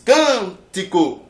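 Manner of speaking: "cântico" with CÂN loud and drawn out; sílaba forte marcada. Shown as a demonstration. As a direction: lean long and hard on the first syllable of "cântico". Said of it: "Onde é que está a sílaba forte?". ("cântico" com o CÂN forte e alongado)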